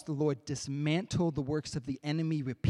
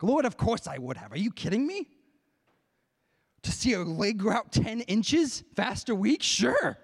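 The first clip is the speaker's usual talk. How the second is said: incredulous, higher-pitched "are you kidding me" eagerness; retold eager yes, punched louder at the end; eager answer; 8 semitones higher, 5 dB louder than usual. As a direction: answer with keen, emphatic certainty, pitch leaping up. Eager answer to God's question.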